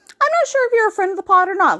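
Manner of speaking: high, fast, bubbly; excited. Real, not acted. Excited address to friend.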